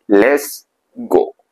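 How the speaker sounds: ordinary talk, nothing marked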